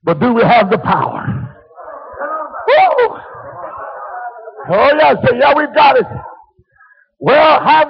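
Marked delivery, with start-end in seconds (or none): 2.7-3.2 sudden high falsetto whoop. Real, not acted. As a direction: let out a joyful whoop. Preacher whoops mid-sermon.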